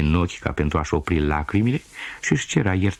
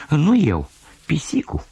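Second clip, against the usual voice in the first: put-on little girl's denial; 10 semitones higher, 3 dB louder than usual. Girl denies breaking the cup.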